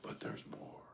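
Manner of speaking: hushed dramatic whisper. Acted. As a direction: whisper it like a teaser. Mock infomercial aside.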